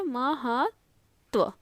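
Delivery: महत्व very slowly, "ma-haat... tva"; drawn-out, separated syllables in महत्व; sounded out. Shown as a demonstration. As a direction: split महत्व with a long gap before the last part. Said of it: "ऐसा".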